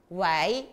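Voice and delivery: 웨이 starting low and rising; second tone. Shown as a demonstration. Teacher models second tone for students, 웨이 rising. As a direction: lift 웨이 upward from a low start. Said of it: "이성으로 해보세요".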